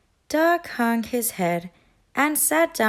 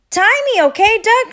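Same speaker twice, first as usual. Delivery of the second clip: bossy duck voice; 10 semitones higher, 8 dB louder than usual. A narrator's reading. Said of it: "said Thistle".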